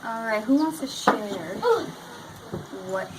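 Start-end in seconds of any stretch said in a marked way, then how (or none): none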